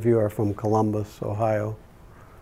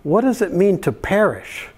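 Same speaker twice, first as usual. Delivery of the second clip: little daughter's voice; 7 semitones higher than usual. Retold or imitated. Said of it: "And she goes".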